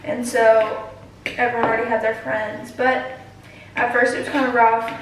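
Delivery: ordinary talk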